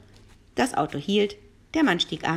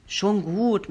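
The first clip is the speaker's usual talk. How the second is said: usual speaking voice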